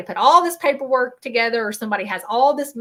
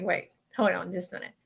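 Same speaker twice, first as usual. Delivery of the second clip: ordinary talk, nothing marked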